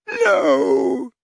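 high anguished groan of no; a pained, pleading no; old man in agony. A narrator's reading. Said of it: "he groaned".

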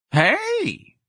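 very high drawn-out hey; janitor's exclamation. Acted. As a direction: let out a long high hey.

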